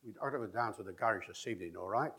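quick stress-timed Cockney, weak syllables squashed; traditional Cockney rhythm. Shown as a demonstration. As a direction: run it along in a ti-TUM Cockney lilt. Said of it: "Ti-tum, ti-tum".

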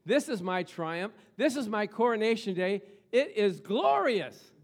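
D L Moody insisting loudly on his triumph; triumphant reply. Retold or imitated. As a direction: rise in triumph to glory. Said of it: "Moody replied".

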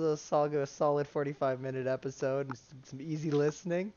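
usual speaking voice

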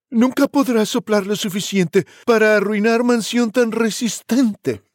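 voz de cerdito más aguda, alarga el final; desafía al lobo con voz aguda y segura; defiant piglet. Acted. (tercer cerdito desafía al lobo con voz aguda)

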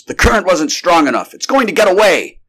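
high, loud and urgent; dismayed cry. A narrator's reading. Doctor to his men as the monster flees, dismayed cry. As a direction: cry out as the quarry escapes. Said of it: "he cried as he received negative answers".

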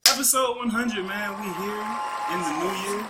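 loud, high hype-man opener; podcast host kicking off episode one hundred, pumped; hype intro. Real, not acted. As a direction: announce the milestone like a big event.

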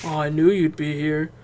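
bored monotone, lightly mocked; emotionless Bran voice. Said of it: "he had no emotion whatsoever".

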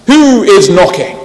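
loud, slow call through the church door; abbot shouting. A narrator's reading. Abbot calling out to the herald, loud and slow. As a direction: boom it out slowly from inside the church. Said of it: "the abbot shouted out".

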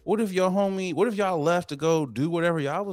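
mock outraged lecture; exasperated rant. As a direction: vent at the neighbor, rising. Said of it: "And I was just like".

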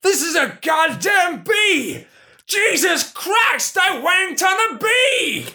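high horrified shout. Acted. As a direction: shriek it in panic, high. Boss horrified discovery.

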